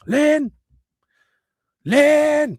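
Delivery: appeler le nom très haut, traîné; appel criant. Retold or imitated. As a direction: crier le prénom d'une autre pièce. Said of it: "Puis il crie". (grand-père qui appelle Lynn)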